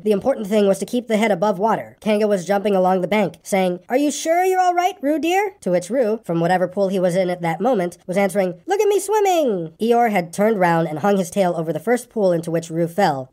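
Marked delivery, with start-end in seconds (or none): 3.9-5.5 high-pitched worried mother; high, anxious motherly calling. A narrator's reading. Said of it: "called Kanga anxiously". 8.7-9.7 very high, drawn-out gleeful squeak; high, slow proud squeak. A narrator's reading. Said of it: "squeaked Roo".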